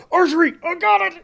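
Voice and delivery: very high, manic mock squeal; hammed-up frantic overexcited archer voice; coked-up archer. Streamer mocking Riss's sudden archery craze, manic high voice. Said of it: "does a couple of lines of coke".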